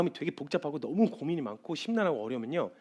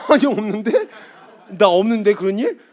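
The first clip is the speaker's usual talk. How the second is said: loud laughing no; laughing denial; 5 semitones higher, 11 dB louder than usual. Retold or imitated. Teacher laughing off a student's worried question, loud. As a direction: laugh it off while insisting.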